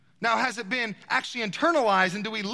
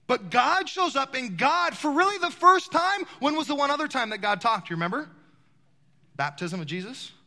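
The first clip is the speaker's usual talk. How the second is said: ordinary talk, nothing marked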